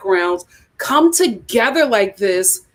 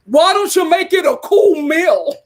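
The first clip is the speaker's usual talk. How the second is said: put-on higher, louder brash New York accent; New York lady; 7 semitones higher, 4 dB louder than usual. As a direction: bark it like a pushy New York clerk. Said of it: "a lady was just like".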